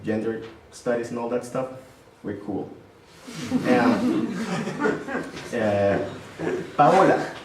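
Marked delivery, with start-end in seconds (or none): 3.7-5.5 laughing up high. Real, not acted. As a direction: break into a laugh. Laughs about gender studies joke.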